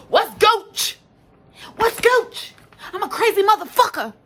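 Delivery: high, very loud imitated yelling; all-out shouting. Mimicking the girl who was all outside after a fight, shouting. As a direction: holler it wildly, challenging everyone. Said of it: "I ain't hear no baby voice".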